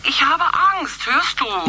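mother pleading with her son, scared and slow; pleading fear. Acted. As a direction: beg him, frightened and drawn out. Mother pleading, more frightened.

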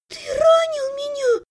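high, drawn-out wail; shocked outcry. A narrator's reading. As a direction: accuse your brother in shocked high pitch. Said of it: "потрясённо выдохнула она". (потрясённый тонкий вопль)

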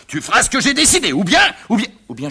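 high, loud father laying down law; angry ultimatum. Acted.

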